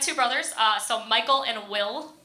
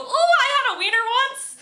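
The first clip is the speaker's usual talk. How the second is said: high, loud excited coo; high delighted coo at a passing dog; delighted squeal; 13 semitones higher, 5 dB louder than usual. Retold or imitated. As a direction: gush over the dog like a fan.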